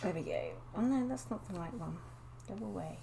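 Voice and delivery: ordinary talk, nothing marked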